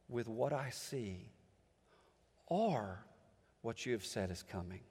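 a preacher murmuring Habakkuk's anguished prayer; hushed lament. Acted. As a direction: let the doubt fall almost to a breath. Preacher voices prophet's quiet lament.

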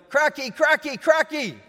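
put-on high, loud, excited baby voice; imitating his daughter demanding crackers; toddler yelling. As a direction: squeal it like a greedy one-year-old. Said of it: "and yelling".

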